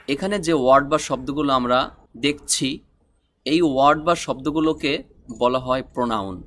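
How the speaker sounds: ordinary delivery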